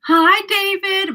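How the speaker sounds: high, loud, drawn-out hello; excited greeting. Real, not acted. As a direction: greet your brother with a big, slow, high call. Greets brother arriving in chat.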